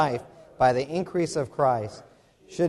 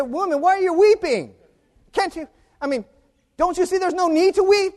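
high, loud, pleading reproach; exasperated angels; 14 semitones higher, 5 dB louder, faster than usual. Acted. Angels to weeping Mary at the tomb, exasperated. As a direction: cry out in disbelief at needless weeping.

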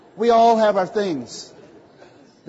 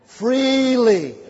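"Freely" repeated alone, higher and louder, pulled long; emphatic freely; 6 semitones higher, 6 dB louder than usual. Shown as a demonstration. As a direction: land hard on "Freely" with a high falling tone. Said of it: "He's willing to give you living water freely".

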